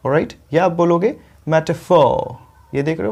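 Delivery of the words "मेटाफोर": मेटाफोर as MET-uh-fawww, r silent; alternative long ending. Shown as a demonstration. Teacher offers a second pronunciation, मेटाफोर with drawn-out faw. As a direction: linger on the last vowel of मेटाफोर. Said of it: "यहाँ पे थोड़ा सा रुकना है".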